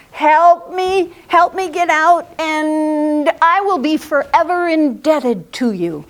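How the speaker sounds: loud, high call of a trapped tiger; pleading tiger. A narrator's reading. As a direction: beg at full voice as a tiger desperate for rescue. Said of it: "He was growling, but then he thought".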